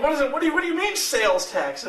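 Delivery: high-pitched retold disbelief; baffled indignation. Friend questions him about sales tax, high and baffled. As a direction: imitate someone thrown by a new term, voice high.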